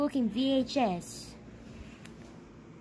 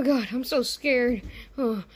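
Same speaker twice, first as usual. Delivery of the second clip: normal talking voice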